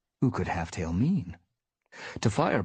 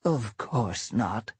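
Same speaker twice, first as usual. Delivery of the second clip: slow voice cracked with age; cracked old voice; 4 semitones higher, much slower than usual. Acted. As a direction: croak it like an old she-cat. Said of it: "in a voice cracked with age".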